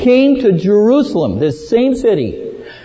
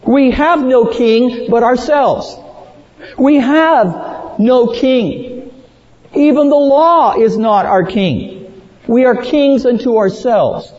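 higher-pitched, emphatic declaration of defiance; mild imitated outcry; 7 semitones higher than usual. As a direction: cry it out as a preacher quoting rebels. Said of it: "men cry out".

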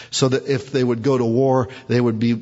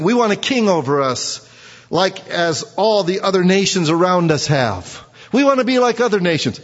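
high, louder mocking plea of the crowd; mocking whine; 7 semitones higher, 3 dB louder than usual. Preacher mocks the people demanding a king, high and louder. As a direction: parody the people begging for a king.

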